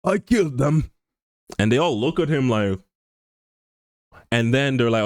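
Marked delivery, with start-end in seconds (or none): 0.0-0.9 higher-pitched offhand admission in character; put-on character confession; Vegeta. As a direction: play the anime villain owning up, voice lifting. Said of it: "Vegeta's just like".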